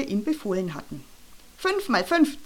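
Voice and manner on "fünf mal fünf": high-pitched hissed quiz question; stern teacher's mild hiss. A narrator's reading. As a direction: fire it at the pupil, pitched high. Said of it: "zischte Frau Gift".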